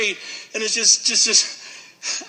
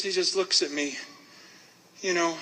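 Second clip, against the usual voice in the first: ordinary delivery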